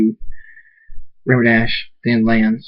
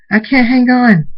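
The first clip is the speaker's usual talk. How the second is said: high, loud panicked yell; screamed alarm; 13 semitones higher, 6 dB louder than usual. A narrator's reading. Rain can't hang on in the storm, screaming. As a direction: yell for help while losing grip. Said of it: "Rain screams".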